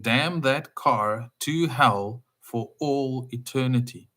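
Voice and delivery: slow, weighty, every word placed; solemn slow curse. Shown as a demonstration. Preacher to listeners, reciting the full curse slowly and gravely. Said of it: "I will say it one more time".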